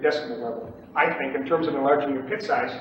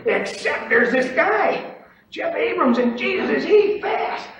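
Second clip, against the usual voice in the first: high-pitched, rising worked-up retelling; exasperated awe; 11 semitones higher, 4 dB louder, slower than usual. Retold or imitated. Trader griping at home about a faster rival, exasperated awe. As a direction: build from loud to amazed outcry.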